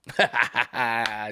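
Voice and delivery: staccato ha-ha laugh; a run of quick ha-has. Real, not acted. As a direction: laugh at your own joke. Laughs at own joke, just kidding.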